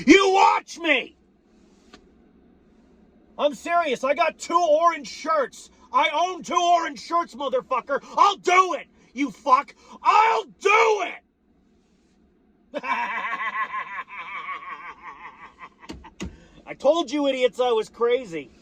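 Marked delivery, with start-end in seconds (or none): 0.1-1.2 high, slow, strained yell; a ranting fan yelling his dare; defiant shout. Real, not acted. Defiant threat escalates to shout. 3.4-12.8 high-pitched manic rant; high, frantic, over-the-top rant; crazed ranting. Real, not acted. Ranting shout about orange shirts. 12.9-15.3 long unhinged cackle after a rant; long crazed laugh; maniacal laugh. Real, not acted.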